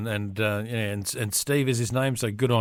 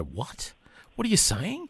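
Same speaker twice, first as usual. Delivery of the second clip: acted bafflement; 6 semitones higher, 5 dB quieter than usual. Husband failing to follow his wife's Irish accent, mock-baffled. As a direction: replay baffled incomprehension, voice leaping up.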